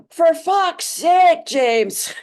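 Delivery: loud, high, slow Irish exclamation; exasperated Irish husband's outburst; Irish accent. Retold or imitated. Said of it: "His Irish accent".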